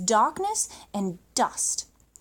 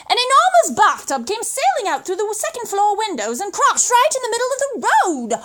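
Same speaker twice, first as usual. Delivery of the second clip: higher and louder, building to the crash; thrilling storyteller burst; excited crash narration; 8 semitones higher, 7 dB louder than usual. A narrator's reading. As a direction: tell it like it's happening right now. Bathtub crashes into road.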